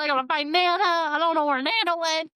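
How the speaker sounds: rising, fast, half-crying mock panic; hammed-up panic, voice climbing. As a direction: play a friend frantic over a lost grandma. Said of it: "Full blown panic".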